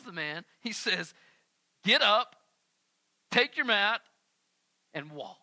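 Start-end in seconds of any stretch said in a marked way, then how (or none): 1.8-5.4 louder, bold command. Retold or imitated. Jesus commanding the man to walk, loud. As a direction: order him to get up. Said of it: "Jesus says".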